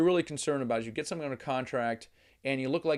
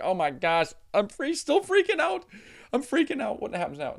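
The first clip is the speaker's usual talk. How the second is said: high, loud hammed-up panicky squeal; mock panic; 9 semitones higher, 5 dB louder than usual. Buyer imitating his own panic after signing, mock high panicked squeal. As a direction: play the new buyer freaking out in mock terror. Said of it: "Now you're like".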